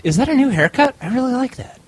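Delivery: high, loud flattering little voice. Retold or imitated. Peanut bowl complimenting the drinker in a tiny high voice. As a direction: squeak out a tiny sweet compliment. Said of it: "Here's the voice again".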